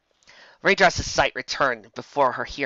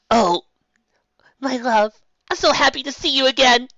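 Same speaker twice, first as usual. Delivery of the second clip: high, louder lovestruck gush; joyful reunion; 12 semitones higher, 6 dB louder than usual. A narrator's reading. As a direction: melt into it with relief. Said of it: "she said".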